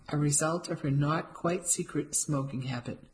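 ordinary delivery, nothing marked

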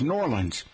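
"Norlands" run together as NOR-linz; "Norlands" as two slurred syllables; native pronunciation. Shown as a demonstration. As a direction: squeeze New Orleans into "Norlands". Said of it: "natives pronounce it as".

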